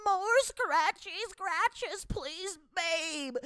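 put-on squeaky, slow baby talk. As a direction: whine like a pampered toddler. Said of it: "she gives him the scratchy scratches".

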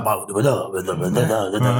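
lightly mocking, low understated mumble; low mock babble; mocking French acting. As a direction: imitate their small, low-key acting. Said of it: "eux, c'est, tu sais".

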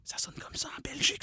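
whisper. Real, not acted. Whispered aside during phone call.

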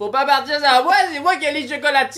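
high, fast, whining; whiny Clyde voice. Acted. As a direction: voice a needy tagalong, high. S0 voicing Clyde.

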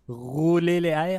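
rolling the R in "rouler" and "airs", slow, high and loud; rolled R. Shown as a demonstration. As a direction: trill every R in "rouler les airs", dragged out. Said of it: "ça dérange pas de, euh, rou".

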